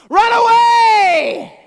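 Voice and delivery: drawn-out full-force scream, very high; piercing all-out yell, stretched out; God screaming. Acted. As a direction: scream it long and loud. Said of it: "You know what he screamed?".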